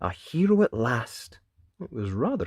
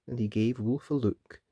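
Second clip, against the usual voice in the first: plain reading voice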